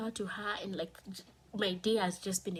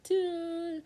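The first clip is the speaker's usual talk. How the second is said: high, louder hollow doo; flat doo sound; 10 semitones higher, 5 dB louder than usual. Retold or imitated. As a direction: voice the empty numbness as a doo. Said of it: "My heart was still beating, but".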